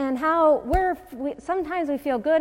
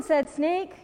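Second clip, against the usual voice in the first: ordinary delivery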